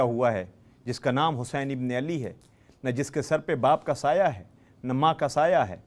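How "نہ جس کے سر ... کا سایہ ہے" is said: usual speaking voice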